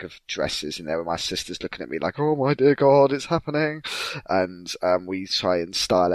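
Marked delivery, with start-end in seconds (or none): 2.2-3.8 high, loud delighted dread; gleeful sister. Retold or imitated. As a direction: savour the coming drama.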